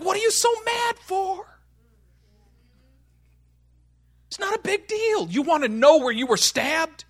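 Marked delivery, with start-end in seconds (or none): 0.0-5.3 very high, incredulous voice; baffled. Acted. Onlooker to someone angry over a touch, baffled. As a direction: squeak out your disbelief.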